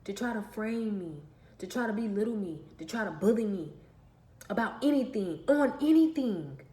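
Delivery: rising into a loud defiant declaration; defiant statement growing to a loud finish; building defiance. Real, not acted. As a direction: build from firm to forceful and loud.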